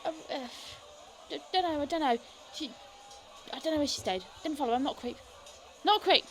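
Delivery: high, jittery voice; scared informant under intimidation; frightened informant. Acted. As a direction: stammer it out, terrified. Voices nervous informant at length.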